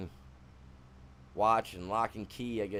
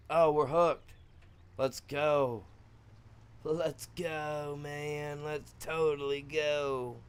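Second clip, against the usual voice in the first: put-on surfer dude, higher pitch; surfer boy talk; 6 semitones higher, much slower than usual. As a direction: stretch it out like a mock surfer bro. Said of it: "Switch up to some surfer boy talk".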